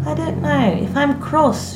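high, decisive reply. A narrator's reading. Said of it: "she said decisively".

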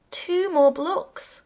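high, loud, slow instruction; crying out. A narrator's reading. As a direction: call it out slowly to the driver. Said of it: "I cried".

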